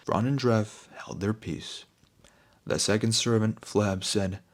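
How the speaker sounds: ordinary delivery, nothing marked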